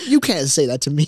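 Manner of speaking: loud, hammed-up indignation; mock hurt. Retelling a reply to youth pastor, mock-offended. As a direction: protest like your pride is wounded. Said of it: "I was so hurt".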